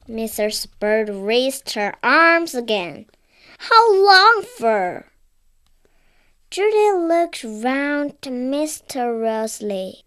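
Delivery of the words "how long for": high, loud, startled; a sharp worried question; alarmed housekeeper. Acted. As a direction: housekeeper demands how long, higher and louder. Mrs Bird alarmed.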